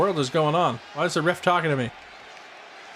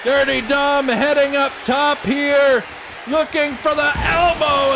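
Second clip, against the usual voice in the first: shouted play-by-play; 8 semitones higher than usual. Acted. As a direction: scream the near falls like a TV announcer. Commentary at full force.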